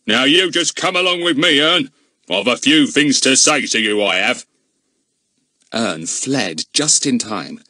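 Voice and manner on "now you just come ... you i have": high, loud blustering constable; pompous policeman. A narrator's reading. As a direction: loom over the boy. Goon threatens Ern.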